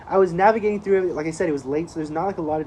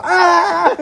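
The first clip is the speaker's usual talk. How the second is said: mock startled yelp; 13 semitones higher, 11 dB louder than usual. Friend startled by flashing high beams.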